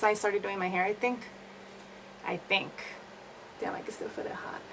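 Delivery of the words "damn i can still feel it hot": quick breathy whisper aside. Real, not acted. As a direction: whisper it under your breath. Aside while hair still hot.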